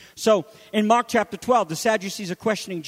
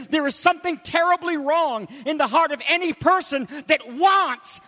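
fervent preaching; 8 semitones higher than usual. Real, not acted. Pastor urging the congregation, preaching fervently. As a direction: build the sermon with full force.

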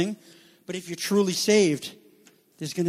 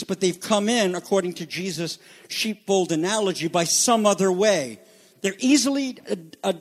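ordinary delivery

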